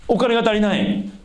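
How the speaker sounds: high-pitched cry of someone in need; desperate plea. Acted. Acted plea for money.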